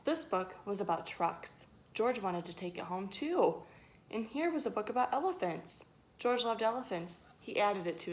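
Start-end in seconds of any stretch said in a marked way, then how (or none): none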